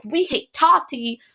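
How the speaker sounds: put-on indignant mob, stretched slow; mock outrage. Vlogger mimicking fans turning on Tati, mock outrage. Mimicking the crowd switching sides.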